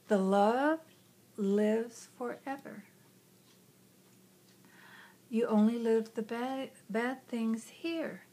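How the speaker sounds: slow, soft, unhurried comforting words; gentle inner voice. Retold or imitated. Inner voice comforts frightened child, slow and soft. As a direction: speak slowly like a tender guiding voice.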